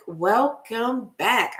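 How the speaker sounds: slow, drawn-out welcome; slow, settled greeting. Real, not acted. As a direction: stretch it out slowly.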